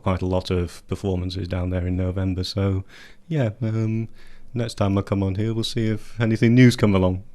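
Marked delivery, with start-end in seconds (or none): none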